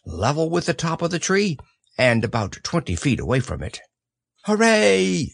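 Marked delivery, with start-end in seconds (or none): 4.4-5.3 a high, loud cheer of delight; shouted hooray. Acted. Christopher Robin cheering Pooh floating by balloon, loud shout. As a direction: throw the hooray up to the sky. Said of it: "you shouted".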